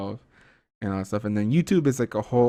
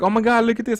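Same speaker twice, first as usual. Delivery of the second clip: high, louder, excited mimicry of a wife; 12 semitones higher, 6 dB louder than usual. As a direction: imitate her eager "come see this". Wife excitedly showing him something.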